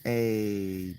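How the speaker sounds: ordinary talk, nothing marked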